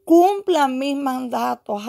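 slow, elongated proclamation; prophetic command. Retold or imitated. Preacher voices God demanding obedience, slow solemn proclamation. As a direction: declare it with stretched opening words. Voicing the Holy Spirit's command.

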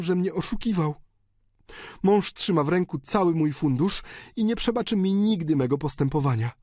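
high, desperate daughter's plea over a dying father; countess pleading. A narrator's reading. Countess begging her dying father for blessing, desperate high plea. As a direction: beg him to come back, voice high and torn.

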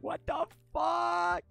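high drawn-out screech; retold scream. Streamer retelling finding a friend at the red light, screaming. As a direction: scream it in stretched-out disbelief. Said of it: "I'm, like, screaming".

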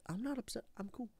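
very soft, contained denial; quiet, unconvincing composure; feigned calm. Pretend. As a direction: shrink down small after the outburst. Insists calm after outburst.

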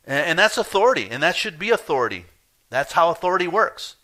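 loud, high emphatic preaching; hammering the word authority; full-force preaching. Real, not acted. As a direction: drive the point home.

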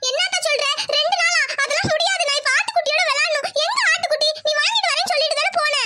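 put-on high-pitched protesting child; demanding little girl. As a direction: whine up high, refusing to wait. Child demands her lamb. (அடம்பிடிக்கும் சிறுமியின் உயர்ந்த குரல்)

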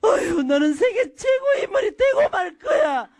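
high, strained praise through clenched teeth; exasperated sigh, then forced praise squeezed out; through gritted teeth. Acted. Parent biting back anger at a child, gritted-teeth praise. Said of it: "이를 악물고라도".

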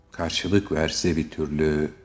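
plain reading voice

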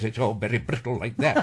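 tight-mouthed, brittle Edinburgh accent. Shown as a demonstration. Actor showing host how Edinburgh sounds, tight brittle Edinburgh accent. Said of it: "If you want to do an Edinburgh accent".

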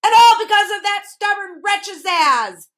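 high, loud outburst of fury; furious roar. A narrator's reading. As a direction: roar it out in anger. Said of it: "roared the math magician".

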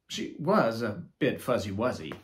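ordinary delivery, nothing marked

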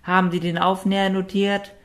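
high, loud call across the room. A narrator's reading. Noti calling back to the counter while being led away, high and loud. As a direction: project it back toward the counter. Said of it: "rief sie zum Schalter".

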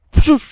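mock zap noise; imitated sizzle. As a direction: burst out a frying sound. Threatens to fry the monsters. (звук шипіння)

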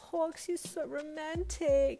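very high, drawn-out swoon; gushing. A narrator's reading. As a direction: gush slowly over the romance. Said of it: "he gushed".

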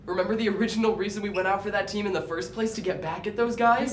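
loud, high accusing outburst; angry confrontation. Acted. Friend confronts friend who ditched him, angry. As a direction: throw it in his face, heated. Friends quarrel over new teammates.

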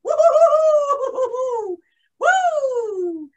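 long high whoops, sliding down; exhilarated whoop. Acted. Pilot doing bank turns in the sky, exhilarated whooping. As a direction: whoop loud and high with the thrill. Pilot exulting during flight manoeuvres.

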